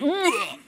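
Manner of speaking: high mock retch; imitated retching. Speaker imitating the nervous keynote after her talk, imitated retching. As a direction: act out throwing up. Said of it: "And she went".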